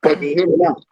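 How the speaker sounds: muffled, boxed-in bad mic sound; like talking from inside a cardboard box. Real, not acted. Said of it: "Still sounds bad".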